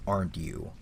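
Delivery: "Aren't you?" dropped low and soft, smug; a condescending little doubt; smirking, doubtful. Acted. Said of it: "maybe clucks its tongue, maybe smirks".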